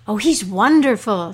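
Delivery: a dog in love with his new friend; breathless happy gush; delighted. Acted. Dog admires the new man.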